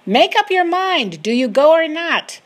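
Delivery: high, loud demanding shout; yelling. A narrator's reading. Soldiers press her to choose, yelling. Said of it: "They yell at me".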